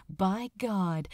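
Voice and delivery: ordinary delivery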